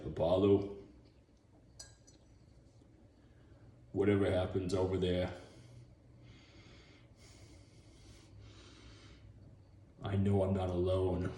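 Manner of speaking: low, soft, solemn delivery; murmured low, as if at a bedside; quiet Rocky line. Acted. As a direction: speak low and hushed to a friend. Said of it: "and he's like".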